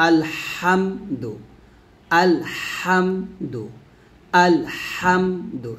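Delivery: very slow আলহামদু with throaty h; pressed ha. Shown as a demonstration. Teacher drilling correct pronunciation, slow pressed ha. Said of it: "গলার মাঝখান থেকে উচ্চারণ করব".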